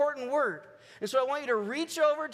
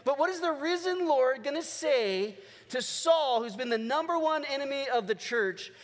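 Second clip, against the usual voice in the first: ordinary talk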